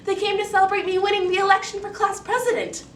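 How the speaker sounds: put-on high, loud child voice; protesting young girl. Girl explaining the celebration to stepmother, high loud protest. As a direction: plead your case, high and insistent. Girl defends her friends.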